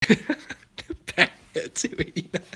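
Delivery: wheezing, slow words squeezed through laughter; helpless laughter. Real, not acted. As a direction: laugh so hard the words barely come out. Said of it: "my face hurts from laughing at that".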